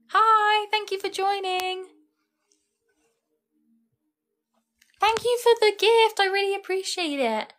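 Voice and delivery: gushing thanks. Real, not acted. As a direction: thank the viewer with delight. Thanking a viewer for a gift.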